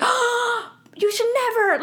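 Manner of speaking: high, shocked imitation of the stylist; high gasping horror. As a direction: gasp it out in mock horror. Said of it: "gasped, she's like".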